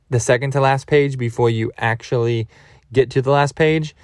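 ordinary talk

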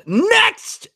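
sudden high shout; Seinfeld-style shout. Acted. Moving the show on in Seinfeld spirit, shouted. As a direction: call it out like a sitcom catchphrase. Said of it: "in the spirit of Seinfeld".

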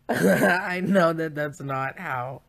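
loud, slow, talking through a laugh; words riding on a big laugh. Real, not acted. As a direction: crack up while admitting it. Laughs at own absurd claim.

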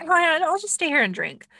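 mock careless Jackie. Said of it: "And she's like".

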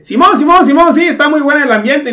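high, loud nervous Simón chatter; hammed-up fake casual. Friend pretending to chat as drummer passes, high nervous babble. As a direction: blurt it like a nervous friend acting natural.

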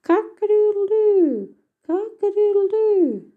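high, drawn-out, loud cockerel crowing; loud rooster crow. A narrator's reading. Cockerel shouting from the roof tree at the robber, loud crowing. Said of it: "shouted out from the roof tree".

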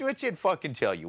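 ordinary talk

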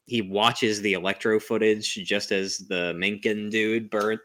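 ordinary talk, nothing marked

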